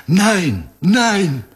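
high frightened cries of refusal; pained cry. A narrator's reading. German girl crying no as the sergeant twists her wrist, high pained cry.

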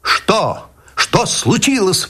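высоко, тревожно; вскрик испуганного доктора; встревоженный выкрик. Acted. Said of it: "выкрикнул я". (тревожный вскрик)

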